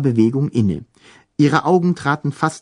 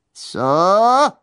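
rising howled question; ghostly howl; 8 semitones higher than usual. A narrator's reading. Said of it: "heulte Jaspara".

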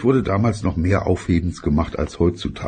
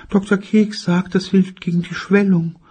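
mother voice. Acted. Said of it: "In Wirklichkeit sagte sie, weil sie einen ... Eiswürfel im Mund hatte".

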